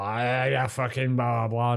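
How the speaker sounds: imitated garbled cursing, voice distorted; mock drunken abuse. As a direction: mimic a drunk ranting nonsense back. Mimicking drunk man's garbled abuse.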